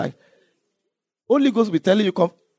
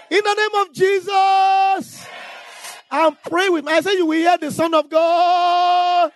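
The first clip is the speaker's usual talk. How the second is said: high, slow, shouted prayer; fervent preacher's shout; preaching at full force; 8 semitones higher, slower than usual. Acted. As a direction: cry the prayer out over the church.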